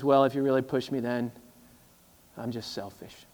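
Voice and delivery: quiet confession. Retold or imitated. Chad confesses selfishness.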